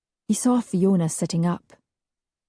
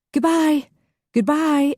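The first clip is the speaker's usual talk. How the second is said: bright, much higher farewell; gaily; 9 semitones higher, 3 dB louder than usual. A narrator's reading. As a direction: sing out a cheery goodbye. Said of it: "everyone said gaily".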